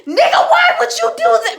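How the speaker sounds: very loud, high outraged yell; angry confrontation. Retold or imitated. Mama confronting the man out back, yelling angrily. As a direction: imitate a furious mother confronting someone.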